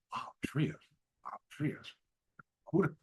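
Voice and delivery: puzzled mutter. Retold or imitated. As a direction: mutter it to yourself, confused. Puzzled repeating caller's name.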